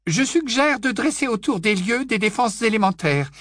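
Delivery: high, grave stern teacher voice; stern old witch. Acted. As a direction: announce it gravely. McGonagall voiced.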